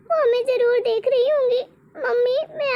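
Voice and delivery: crying. Acted. Praying for late mother, crying.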